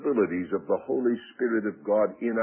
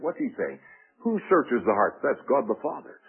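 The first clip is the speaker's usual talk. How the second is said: ordinary talk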